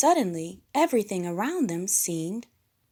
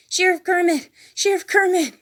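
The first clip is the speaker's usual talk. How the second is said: high, loud urgent calling; loud high alarmed call; Scooter panting; 12 semitones higher, 9 dB louder than usual. A narrator's reading. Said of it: "he panted".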